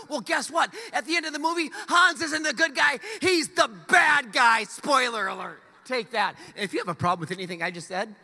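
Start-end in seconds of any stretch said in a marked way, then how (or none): 0.1-6.3 gleeful spoiler shout. Acted. Pastor ranting spoilers at the church, loud and gleeful. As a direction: announce the spoiler with mock triumph.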